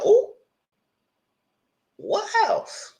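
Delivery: high squealed disbelief; shocked squeal. Real, not acted. Viewer shrieking at the house keys, high and shocked.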